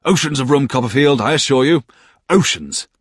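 a cheery friend waving off the cramped table; good-humoured Traddles. A narrator's reading. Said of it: "he protested with his own good humour".

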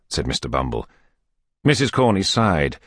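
plain reading voice

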